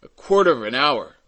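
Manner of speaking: loud, dismayed exclamation; exclaiming. A narrator's reading. Mayor hearing how long rescue takes, exclaiming. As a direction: burst out at the long delay. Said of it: "exclaimed Madeleine".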